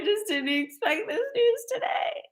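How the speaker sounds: high, tearful voice; retold crying. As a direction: replay herself crying to her husband. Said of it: "I called him crying".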